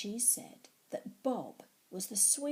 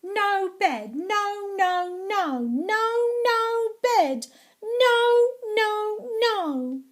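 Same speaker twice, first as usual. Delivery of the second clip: very loud, high tantrum yell; refusing bed; 11 semitones higher, 11 dB louder, slower than usual. Acted. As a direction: scream no over and over, kicking off. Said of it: "I rolled and rolled and said".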